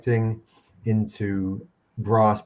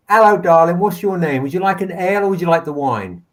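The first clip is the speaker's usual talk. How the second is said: high, bright, louder, sing-song greeting; higher, louder, a touch quicker, flirtatious; flirty barmaid; 6 semitones higher, 5 dB louder, faster than usual. Acted. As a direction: sweet-talk the customer like a saucy tavern wench.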